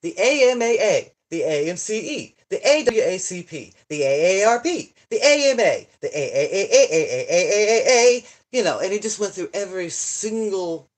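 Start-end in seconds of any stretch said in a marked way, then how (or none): 0.0-8.2 loud, hammed-up recital of acronyms; mock listing. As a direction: rattle them off mockingly. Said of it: "But he goes".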